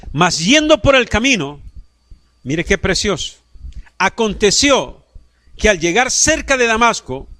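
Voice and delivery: loud, high, slow pulpit reading; preacher declaiming the verse; fervent scripture reading. Acted. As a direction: proclaim each phrase to the congregation.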